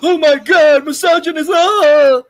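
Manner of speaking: loud, high mock outrage ending in a yell; hammed-up shriek of an offended critic; Grace Randolph. Predicting the reviewer's meltdown, loud high mock outrage. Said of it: "She's gonna have a fucking".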